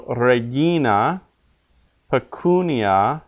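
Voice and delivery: slow reGIna, peCUnia; stress on penult. Shown as a demonstration. Teacher giving examples of long penult, slow regina, pecunia with stressed GI and CU. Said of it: "accented on the penult".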